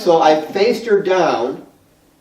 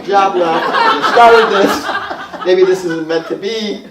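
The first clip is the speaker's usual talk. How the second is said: laughing plea; 4 semitones higher, 5 dB louder, much slower than usual. Retold or imitated. Pleading with dead client, amid laughter.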